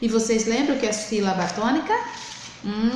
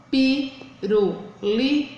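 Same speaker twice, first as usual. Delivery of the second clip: "pirulito" slowly split, pi... ru... li... to; syllable by syllable; 3 semitones higher, much slower than usual. Shown as a demonstration. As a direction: sound out "pirulito" in four beats. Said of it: "quatro sílabas". ("pirulito" devagar, sílaba por sílaba)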